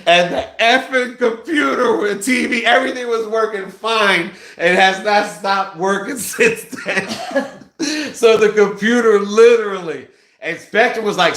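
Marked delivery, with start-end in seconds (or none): 0.1-10.1 laughing. Real, not acted. Laughing retelling, pitch up.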